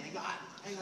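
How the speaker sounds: normal talking voice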